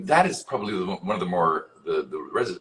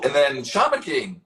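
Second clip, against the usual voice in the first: ordinary delivery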